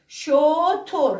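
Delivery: شتر stretched very slowly, sound by sound; teacher sounding out شتر for the class. Shown as a demonstration. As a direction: drag out شتر to show the o after ش. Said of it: "اینجا شتو رو ببینید".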